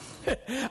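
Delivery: short mirthless chuckle; a smug little laugh to yourself; a cold satisfied chuckle; dry chuckle. Acted. Scrooge pleased at workhouses.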